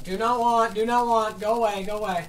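high, loud playful protest; loud refusal. Real, not acted. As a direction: yell it like a meme. Refusing a pile of sand.

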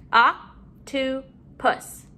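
"Octopus" slowly, in three beats; syllable by syllable. Shown as a demonstration. Teacher modeling syllables to put together, broken up. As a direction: pause after each syllable of "Octopus". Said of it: "let's put our syllables together".

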